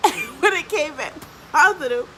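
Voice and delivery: high, words breaking into laughter; laughing while talking. Real, not acted. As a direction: tell it through a laugh. Laughing while telling the test result.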